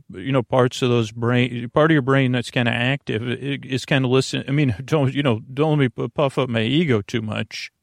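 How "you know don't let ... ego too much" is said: ordinary talk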